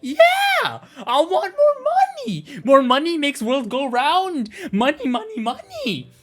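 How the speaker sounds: loud, high, stretched-out cheering; money-crazed glee. Pretend. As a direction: revel greedily, drawing out the words. Gleeful money chant.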